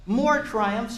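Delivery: ordinary delivery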